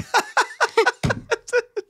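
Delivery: high, hammed-up sales laugh; a big put-on laugh to close the sale; fake laugh. Shown as a demonstration. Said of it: "you have to be like".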